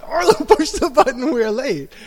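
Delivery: loud, high, laughing mock scolding; mock angry parent. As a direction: hurry the child up in a half-laughing yell. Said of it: "I got mad at her".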